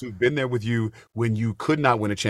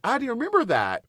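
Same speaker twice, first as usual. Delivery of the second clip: kind cover; 12 semitones higher than usual. Retold or imitated. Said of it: "he was like".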